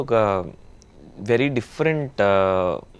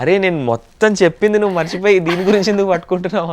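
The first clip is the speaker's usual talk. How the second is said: raised, higher retelling of his own frustrated reply; exasperated retort; 6 semitones higher, 6 dB louder than usual. Retold or imitated. Man retelling what he told her, raised and exasperated. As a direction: push it up like you're fed up again.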